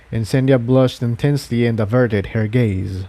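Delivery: ordinary delivery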